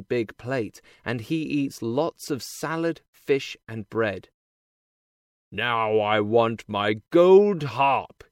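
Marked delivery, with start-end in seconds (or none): 5.5-8.2 loud, slow order from the big man; giant's shout. A narrator's reading. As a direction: shout it slowly as a demanding giant. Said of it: "he shouts".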